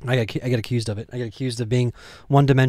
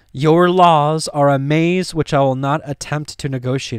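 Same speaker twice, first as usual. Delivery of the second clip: higher, slow, deliberate scripture reading; weighty read-aloud of an old text; dramatic reading of Pilate; 5 semitones higher, 4 dB louder than usual. Acted. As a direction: read Pilate's scorn out slowly. Reads Pilate's speech.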